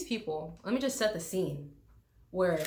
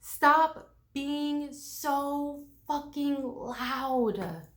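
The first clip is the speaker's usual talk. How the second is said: each word spaced and stressed; slow stern lecture; emphatic, slow; 4 semitones higher, much slower than usual. Shown as a demonstration. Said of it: "listen closely".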